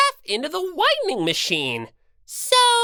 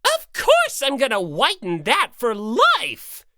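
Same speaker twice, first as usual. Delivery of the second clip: usual speaking voice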